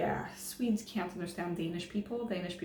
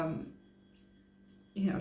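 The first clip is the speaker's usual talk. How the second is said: ordinary talk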